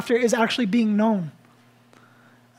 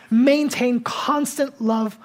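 "Maintain constant love" drawn out very slowly, louder; emphatic slow; 4 semitones higher, 4 dB louder, much slower than usual. Acted. Pastor driving home the verse, slow and weighty. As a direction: lean into each word of "Maintain constant love". Repeating the sermon's key phrase.

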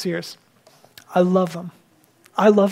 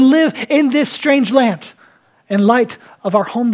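raised, emphatic sermon delivery; fervent preaching; 3 semitones higher, 5 dB louder than usual. Real, not acted. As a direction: exhort the congregation with conviction. Preaches with rising force.

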